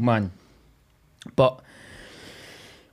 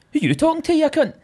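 mocking, much higher squeaky retort; 14 semitones higher than usual. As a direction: throw it back in a squeaky mock voice. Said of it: "I, I was like".

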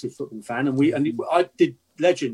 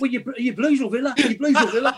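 high-pitched, eager Brummie questioning; Brummie accent; 10 semitones higher than usual. Acted. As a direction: pester a stranger in a put-on Brummie accent. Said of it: "If I hear a Brummie accent".

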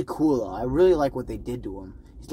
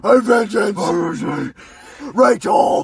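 growled, raspy shout; hammed-up superhero roar, dragged out; 6 semitones higher, 8 dB louder, much slower than usual. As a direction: bellow it in an exaggerated harsh growl.

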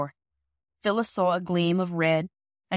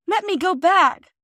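high, loud, slow pleading cry; a frightened girl crying out to go back; 12 semitones higher, 8 dB louder, slower than usual. A narrator's reading. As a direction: beg to be let go, terrified. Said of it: "cried Phyllis".

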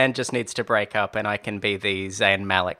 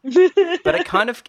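excited outburst; 9 dB louder, slower than usual. Real, not acted. Sudden burst while praising show.

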